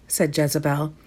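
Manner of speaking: ordinary delivery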